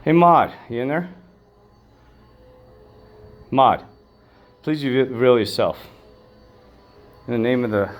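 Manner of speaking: loud call into the empty room. Real, not acted. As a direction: summon her, call her name out loud. Calling out to a ghost.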